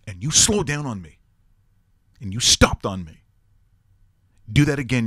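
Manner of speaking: изображая разгневанного тренера, громко и с нажимом; angry coach's dressing-down, punched out in bursts; сердитый тренер. Retold or imitated. Acting out coach's furious rebuke.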